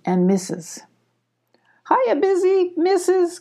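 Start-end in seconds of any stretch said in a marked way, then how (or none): 1.9-3.4 high-pitched cheery call to neighbors. A narrator's reading. Abby greets the beaver neighbors on arrival, calling out. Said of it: "called Abby".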